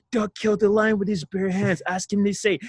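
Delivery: put-on a kid bragging to the whole school in a high voice; excited schoolboy gossip. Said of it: "he goes around the whole school".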